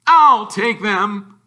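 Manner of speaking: loud, high, slow gruff grab; snatch them with slow bluster; Uncle Vernon. A narrator's reading. Uncle Vernon to the owner, slow and loud.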